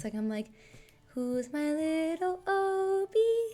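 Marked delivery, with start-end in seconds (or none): 1.1-3.5 high, slow sing-song cooing; sweet pet voice, high and drawn out. Retold or imitated. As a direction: coo it like singing to a cat. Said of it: "like, sweet voice".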